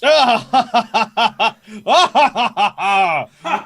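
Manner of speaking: high, loud, long booming guffaw; hearty belly laugh. Acted. Jovial captain asked for mead, roaring with laughter. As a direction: roar with laughter in bursts.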